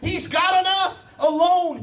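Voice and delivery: high, loud exultant preaching; preaching at full force. Acted. Preacher rejoicing before the church, exultant shout. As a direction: shout it to the rafters with joy. Peak of sermon with laugh.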